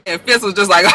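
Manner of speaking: usual speaking voice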